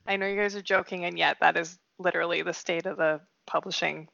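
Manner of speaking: usual speaking voice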